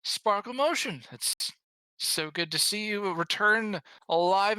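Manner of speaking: put-on high, warm old official; old man voice. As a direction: greet them as a kindly elder. GM voices Sildar.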